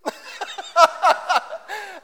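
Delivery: high, long laughter; real laugh at his mum's reaction. Real, not acted.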